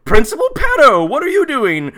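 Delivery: high, loud pretend victim's yell; mock scream. Victim naming the principal as killer, mock scream. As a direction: shout it like a hammed-up victim. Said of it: "All she does manage to scream".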